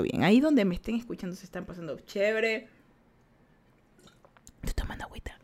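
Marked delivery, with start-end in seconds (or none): 4.6-5.4 whispered aside. Real, not acted. Aside while drinking water.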